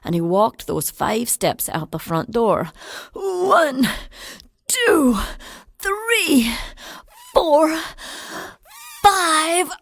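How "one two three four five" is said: strained, rising count under a heavy load; effortful counting, climbing higher; straining count. Acted. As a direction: count each step as the bucket drags. Old man counting steps again.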